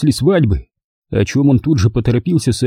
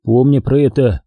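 ordinary delivery

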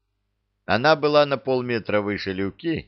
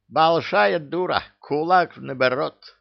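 upset; 7 semitones higher than usual. Acted. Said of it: "расстроился Авоська".

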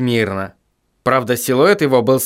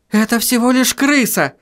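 shout; 10 semitones higher than usual. Acted. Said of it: "крикнула Сахариса".

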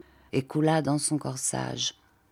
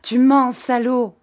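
higher, louder angry yell; furious shout; 8 semitones higher, 7 dB louder than usual. A narrator's reading. Exasperated girl screams at the marquis, furious. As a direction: shout it in his face. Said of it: "lui cria dans la figure".

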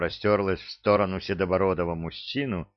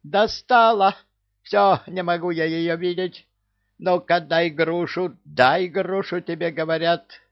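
hysterical outburst; 10 semitones higher than usual. A narrator's reading. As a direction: demand the pear in a frenzy. Parrot hysterical.

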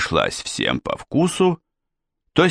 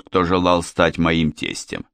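ordinary delivery

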